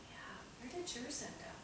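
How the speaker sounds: whispered question. Retold or imitated. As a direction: whisper it kindly to the child. Counselor asks the child quietly.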